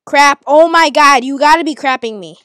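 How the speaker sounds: loud, high, exasperated outburst; furious yelling. Real, not acted. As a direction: blow up at the game. Raging at losing a fight.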